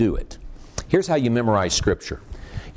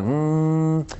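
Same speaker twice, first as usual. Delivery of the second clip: long, level mantra hum; mock a meditator with a sustained "mmm"; drawn-out one-note chanting hum; meditation hum; 2 semitones higher than usual. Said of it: "transcendental meditation where you're going".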